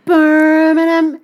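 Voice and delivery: exaggerated, drawn-out Brummie; loud, slow, high mock Birmingham accent. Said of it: "I'm from Birmingham".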